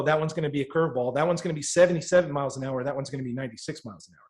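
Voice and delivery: quick-fire, matter-of-fact coach; rattled-off pitch calls. Retold or imitated. Gifted hitter coaching batters on pitches, rattled off fast.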